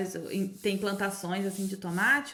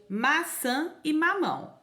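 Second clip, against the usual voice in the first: normal talking voice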